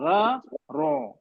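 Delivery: را light then رو heavy, very slow; contrast raa and ro. Shown as a demonstration. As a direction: drag out را then رو to show the difference. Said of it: "فرق ہے نا".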